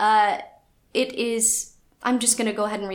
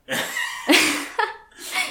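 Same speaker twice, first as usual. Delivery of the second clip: breathy laugh; 6 semitones higher, 6 dB louder than usual. Real, not acted. Laughing after French accent.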